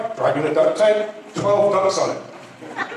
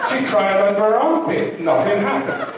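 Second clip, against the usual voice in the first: ordinary talk, nothing marked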